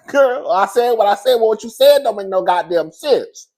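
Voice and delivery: loud, high back-and-forth; mock argument. Arguing with Angie about what she said, loud.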